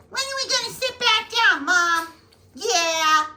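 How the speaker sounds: high pleading puppy voice; dog voice. Pretend. Dog asks mom to sit back down, high pleading voice. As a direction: beg Mom like a needy pup.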